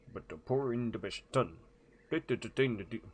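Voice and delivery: garbled mock-Swedish sing-song; Swedish Chef voice. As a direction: stumble through it in a comic Swedish accent. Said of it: "the Swedish chef voice".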